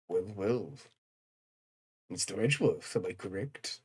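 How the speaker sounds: quiet, sly character voice; deep game character. Acted. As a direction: purr it low and knowing.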